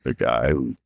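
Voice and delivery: very low, raspy action-hero voice; Vin Diesel. Retold or imitated. Actor mimicking his wrecked audition voice, low Vin Diesel rasp. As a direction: drop deep into a worn-out rasp. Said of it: "I literally sounded like Vin Diesel".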